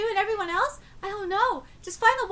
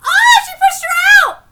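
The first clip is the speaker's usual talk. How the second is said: a sudden, much higher, much louder shocked cry; shocked outburst; 12 semitones higher, 17 dB louder than usual. Real, not acted. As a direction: blurt it out in real shock. Shocked by on-screen event.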